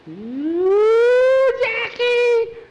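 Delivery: very high, loud panicked cry; high shrieking call; terrified shriek. Acted. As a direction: scream for your friend in terror. Feels long hair in the dark.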